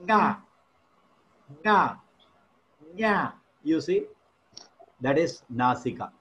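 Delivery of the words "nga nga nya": "nga, nya" sent through the nose; nasal sounds. Shown as a demonstration. Teacher demonstrating nasika, nasalised sounds. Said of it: "you will press your nasika".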